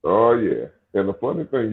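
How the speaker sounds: ordinary talk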